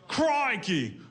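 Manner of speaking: high, far Aussie "Crikey!" squawked; mock Australian exclamation. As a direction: blurt "Crikey!" in a cartoon Aussie accent. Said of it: "or whatever the fuck they say over there".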